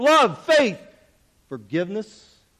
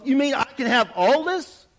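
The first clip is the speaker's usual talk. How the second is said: eager, higher questions; excitement; 7 semitones higher than usual. A narrator's reading. Guest asking the host about the special, excited. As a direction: ask with excitement ringing through. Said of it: "I asked with excitement ringing in my voice".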